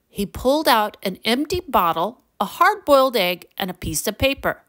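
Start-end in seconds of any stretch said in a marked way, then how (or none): none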